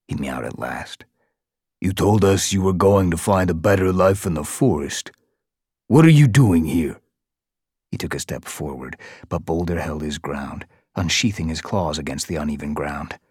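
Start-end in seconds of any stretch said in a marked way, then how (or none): 1.8-7.0 louder, rising scorn; scornful guard Bone. A narrator's reading. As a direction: sneer at a cat who came crawling back.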